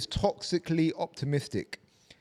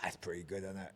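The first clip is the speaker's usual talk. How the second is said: whispered softly; 7 semitones lower, 14 dB quieter than usual. Real, not acted. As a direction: breathe it out under your breath. Whispered aside.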